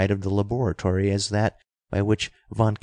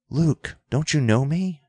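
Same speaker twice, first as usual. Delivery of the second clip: mild narrated shout; 6 semitones higher than usual. A narrator's reading. Dick revealing himself to the old man, mildly shouted. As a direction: call out urgently without really shouting. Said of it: "he shouted".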